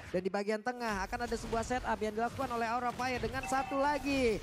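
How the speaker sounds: energetic esports caster calling the action; play-by-play commentary. Acted. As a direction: call the fight live, quick and lively.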